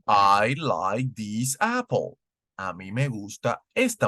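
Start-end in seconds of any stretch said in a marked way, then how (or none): 0.1-2.2 "I like this apple" said slowly and clearly; slow model sentence. Shown as a demonstration. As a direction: read it word by word for learners.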